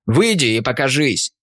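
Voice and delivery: firm command at a stranger. A narrator's reading. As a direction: order him out, no doubt. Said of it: "скомандовал я".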